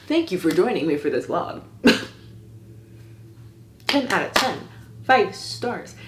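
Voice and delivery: ordinary talk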